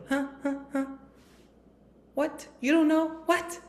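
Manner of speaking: very high, squeaky fake astonishment; mock surprise. Teacher teases silent students, high hammed-up astonishment. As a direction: play up disbelief for comic effect.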